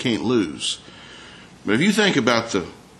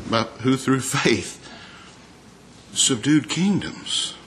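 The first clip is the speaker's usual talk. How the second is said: slow and hushed; 4 dB quieter, slower than usual. Acted. Slow rhetorical question.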